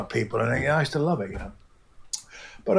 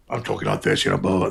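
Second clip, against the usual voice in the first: lower, relaxed everyday voice; just talk in your own low register; normal low voice; 5 semitones lower, faster than usual. Shown as a demonstration. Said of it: "I'm more higher pitched".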